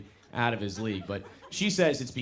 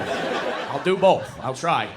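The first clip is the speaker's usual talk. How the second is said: ordinary delivery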